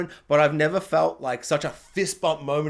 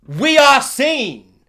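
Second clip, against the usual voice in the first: loud, high, very slow declaration; mock proclamation; 6 semitones higher, 9 dB louder, much slower than usual. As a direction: proclaim it slowly to a whole audience. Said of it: "I wanted to stand up and be like".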